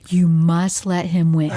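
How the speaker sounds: low, imitated urgent coaching aside; imitating Zach. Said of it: "I saw Zach going".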